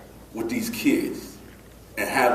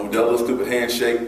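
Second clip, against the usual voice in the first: ordinary talk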